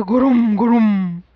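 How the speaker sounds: low, slow grumble; rumbling thunder. A narrator's reading. Child hearing the clouds rumble, low and slow.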